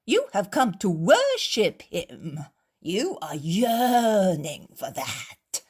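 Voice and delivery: loud, slow, sarcastic drawl; mocking imitation. Innkeeper mocks the Pharisees, imitating their voices. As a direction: ham up their pious words to mock them. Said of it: "The host imitates their voices".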